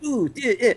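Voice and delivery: little high-pitched hit grunts; short mock oofs of impact; getting punched grunts. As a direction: imitate small yelps from taking punches. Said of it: "sounds like you're getting punched".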